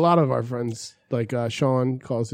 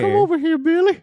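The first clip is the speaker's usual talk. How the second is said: creepy cartoon voice; 14 semitones higher, 3 dB louder than usual. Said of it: "pedophile guy from Family Guy".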